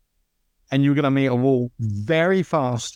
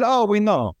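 higher, mock Italian mechanic voice; Italian accent; 7 semitones higher than usual. Driver quoting his Italian team's reply, in a mock Italian accent. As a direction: play the Italian team in a lightly put-on Italian accent. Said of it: "that's my Italian accent".

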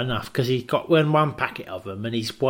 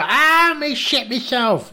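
much higher yelp, then slower; mock Kim wailing; 11 semitones higher, 5 dB louder, slower than usual. A pal mimics Kim soiling himself, high wail. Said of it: "You go".

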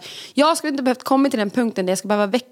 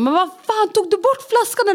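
high-pitched indignant outburst; angry retort; 11 semitones higher, slower than usual. Retold or imitated. New parent recalling rowing with partner over a bottle, angry. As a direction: demand it, exasperated, pitch shooting up. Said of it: "jag blev så arg på Lambert ibland".

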